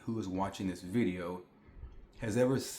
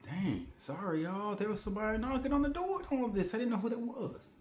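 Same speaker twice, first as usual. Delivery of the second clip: flustered apology; 10 semitones higher, 4 dB quieter than usual. Real, not acted. Apologizing after answering the door.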